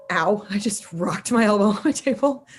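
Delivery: ordinary talk, nothing marked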